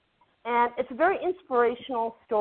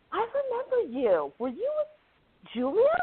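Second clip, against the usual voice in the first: much higher voice, slowing on the question; mock-excited recognition, pitched way up; retold excited recognition; 10 semitones higher than usual. Woman recognizing an actor at the checkout, excited.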